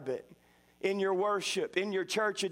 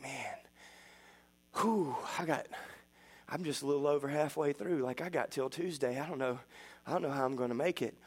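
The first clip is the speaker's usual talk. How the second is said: whispered sigh, then soft, weary and low; miserable and worn out; 5 semitones lower, 4 dB quieter than usual. Retold or imitated. A hungry father five days into a fast, soft and weary. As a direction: sound drained, barely getting the words out. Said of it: "I was absolutely miserable".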